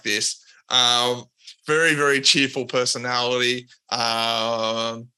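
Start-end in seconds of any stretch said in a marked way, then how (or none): none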